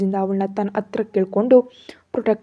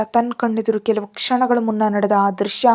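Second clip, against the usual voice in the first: ordinary talk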